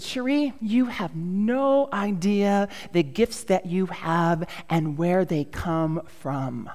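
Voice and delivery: slow, measured pronouncement; solemn boss. Retold or imitated. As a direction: draw it out as a boss imparting wisdom. Boss's solemn pronouncement retold.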